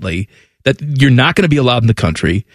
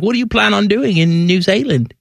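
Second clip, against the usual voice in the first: put-on New Zealand accent, pitched higher; higher-voiced mock New Zealand accent; 5 semitones higher than usual. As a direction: imitate a New Zealand border officer's accent. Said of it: "do you like my accent".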